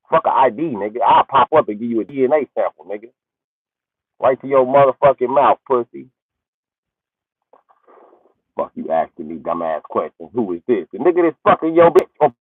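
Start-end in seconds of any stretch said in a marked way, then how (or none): none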